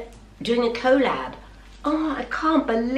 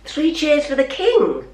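usual speaking voice

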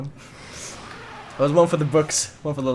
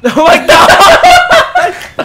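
very high, loud laughing shout; real laughing shriek; 16 dB louder, much slower than usual. Real, not acted. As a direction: scream with laughter.

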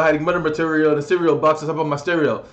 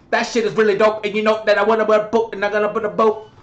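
high, rushed mocking impression; mock rapper babble in a put-on voice; Yellow Wolf; 4 semitones higher, much faster than usual. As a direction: gabble it as a hyped-up hanger-on. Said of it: "Yellow Wolf is like".

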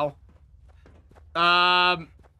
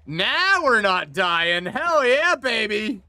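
high, drawn-out, rising whoop; triumphant cheer; 6 semitones higher, 4 dB louder, slower than usual. Real, not acted. Player cheering an escape from death in the game, triumphant. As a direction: stretch it out and climb into a big celebration. Celebrates no longer dying in game.

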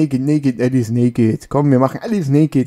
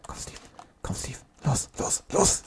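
urgent whisper; 13 dB quieter than usual. Real, not acted. Urging game character Steve on quietly.

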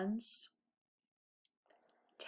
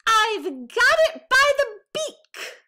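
much higher, much louder triumphant shout; Baby Bear crying out; 14 semitones higher, 12 dB louder than usual. A narrator's reading. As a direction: shout the discovery with all your might. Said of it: "Baby Bear cried".

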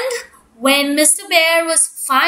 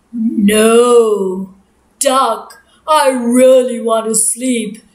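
sleepy grumpy bear; 5 semitones lower, slower than usual. Acted. Bear woken by duck at night, low grumpy groan. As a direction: drag it out like you were dragged from bed.

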